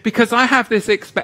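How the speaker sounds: usual speaking voice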